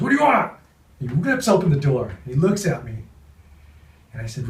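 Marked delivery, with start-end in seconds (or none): 0.0-0.5 angry. Retold or imitated. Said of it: "He goes".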